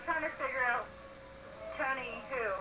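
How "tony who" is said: usual speaking voice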